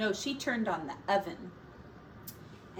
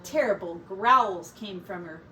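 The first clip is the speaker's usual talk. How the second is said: plain reading voice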